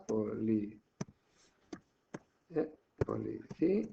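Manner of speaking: policía broken up syllable by syllable with pauses; split into syllables. Shown as a demonstration. Teacher separating syllables for the exercise, halting policía. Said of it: "Lo separo en sílabas".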